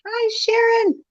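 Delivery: high, sing-out hello; excited greeting. Real, not acted. Guest greeting Sharon in the chat, high excited call. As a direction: call her name out high, delighted.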